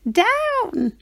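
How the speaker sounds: the witch calling her broom to land; witch crying out. Acted. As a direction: yelp the broom down. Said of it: "cried the witch".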